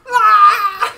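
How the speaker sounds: high, loud shriek of horror; scream of horror. Retold or imitated. Telling friends about the gif, high loud horror scream. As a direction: shriek like you just saw something awful. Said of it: "it's like a scream of horror".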